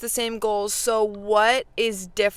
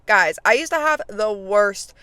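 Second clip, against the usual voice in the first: normal talking voice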